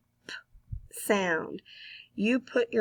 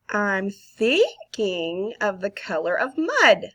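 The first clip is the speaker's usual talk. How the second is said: guessing game clue; 5 dB louder than usual. Shown as a demonstration. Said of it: "I'm Thinking of Something".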